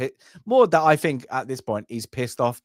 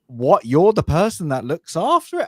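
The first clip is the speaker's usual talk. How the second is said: disbelieving what, pitched higher and louder; incredulous; 6 semitones higher, 5 dB louder than usual. Retold or imitated. Podcaster voicing the viewers' reaction to the young soldier, mock disbelief.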